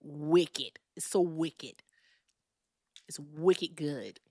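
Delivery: put-on Boston slang, drawn out; Boston accent. Shown as a demonstration. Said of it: "here's my Boston accent".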